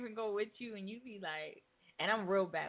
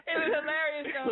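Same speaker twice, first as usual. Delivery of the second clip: laughing; 6 semitones higher, 5 dB louder than usual. Real, not acted. Laughing about the kid story.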